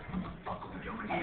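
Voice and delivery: fast laughing whisper; whispered aside. Retold or imitated. Husband whispering to wife at the class, fast whisper. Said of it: "I just looked at my wife and I'm like".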